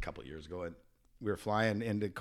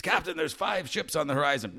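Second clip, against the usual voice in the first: higher called report; yelling down; 6 semitones higher than usual. Retold or imitated. Lookout yelling the sighting to the captain, higher call. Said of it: "The guy yells down".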